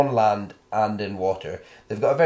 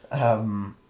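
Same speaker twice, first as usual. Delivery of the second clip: normal talking voice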